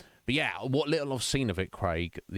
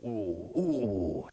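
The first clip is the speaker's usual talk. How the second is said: drawn-out mysterious crooning noise; slow, spooky merchant "ooh"; original merchant. Shown as a demonstration. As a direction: stretch it out like a shady peddler. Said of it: "there's gonna be a bit more".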